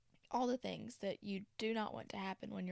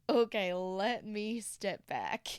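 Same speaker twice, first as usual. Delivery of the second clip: laughing aside; 8 dB louder, slower than usual. Retold or imitated. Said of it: "I said".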